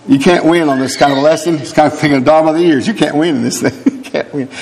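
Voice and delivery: ordinary talk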